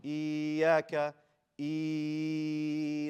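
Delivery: "إياك" with a long even "ee" and no push on y; held vowel. Shown as a demonstration. Teacher shows what not to say, vowel just held. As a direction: drag out the first "ee" of "إياك". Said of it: "It's like you hold it".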